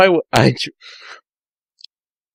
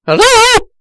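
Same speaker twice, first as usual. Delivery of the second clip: too-high hello; 5 dB louder than usual. Acted. Sonic answers phone, slip.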